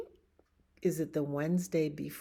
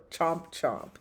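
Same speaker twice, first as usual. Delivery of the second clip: mock chomping. Pigeon eating cookie.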